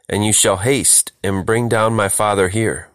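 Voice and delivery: ordinary delivery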